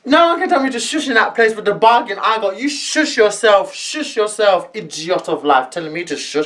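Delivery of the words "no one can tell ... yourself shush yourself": ordinary talk, nothing marked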